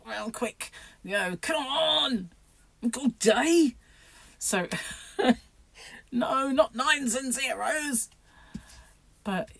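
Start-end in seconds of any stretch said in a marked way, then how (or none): 1.4-3.8 high-pitched, hurrying plea at the dial; raised voice urging it along; mock impatience. Urging a slow rotary dial. 6.1-8.1 high, drawn-out groaning protest; hammed-up dread, slow and pitched up; mock dismay. Acts dismay at slow digits.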